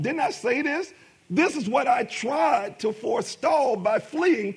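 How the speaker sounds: rising, fervent preaching voice. Acted. Pastor preaching Jonah's story to the congregation, fervent.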